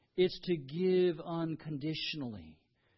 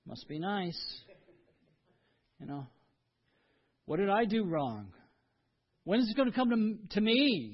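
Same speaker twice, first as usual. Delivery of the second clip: envious muttering. Retold or imitated. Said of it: "And we're thinking".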